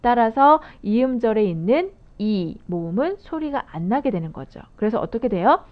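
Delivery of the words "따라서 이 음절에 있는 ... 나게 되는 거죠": ordinary talk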